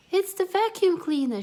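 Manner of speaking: high loud panicked shriek. Acted. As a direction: shriek at the noise. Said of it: "shrieked Melissa".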